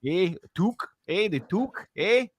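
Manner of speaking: high, questioning Canadian "eh"; cartoonish Canadian accent, rising at each end; Bob and Doug McKenzie. Warming up to find the accent, mock Canadian accent. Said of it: "trying to think of Bob and Doug McKenzie".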